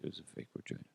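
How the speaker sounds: hushed embarrassed whisper. Acted. Todd whispers the answer again to the reporter. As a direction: breathe it out so nobody hears.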